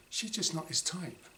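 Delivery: ordinary delivery, nothing marked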